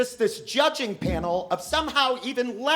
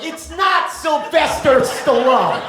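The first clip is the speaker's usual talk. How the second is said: viewer scoff; 5 semitones higher, 7 dB louder, slower than usual. Acted. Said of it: "you're watching at home like".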